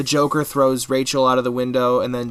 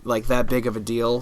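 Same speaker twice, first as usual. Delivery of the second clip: normal talking voice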